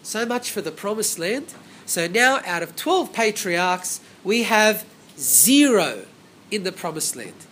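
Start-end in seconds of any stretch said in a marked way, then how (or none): 1.8-6.1 slow build landing hard on "zero"; slow down and hit "zero"; slow emphasis. Real, not acted. Preacher stresses that no patriarch remained, slowly. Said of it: "emphasizing zero patriarchs".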